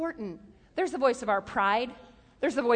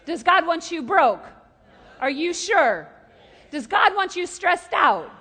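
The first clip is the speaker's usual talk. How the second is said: questions hammered louder and louder to a loud challenge; preaching at full force; 4 semitones higher, 6 dB louder than usual. Acted. Preacher pressing the crowd, rising to a loud challenge. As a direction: build each question until the last one rings out.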